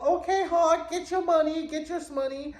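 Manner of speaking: high, gleeful inner cheer; a sly high cheer; egging on. Retold or imitated. Inner thought cheering Hawk on.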